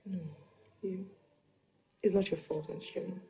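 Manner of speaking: soft, breaking near-whisper; barely voiced, holding back tears; tearful whisper. Consoling aunt, near tears.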